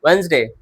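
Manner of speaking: "वेडनेसडे" in two syllables, no first D; silent D. Shown as a demonstration. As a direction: correct "वेडनेसडे" by dropping the first D. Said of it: "वेडनेसडे नहीं".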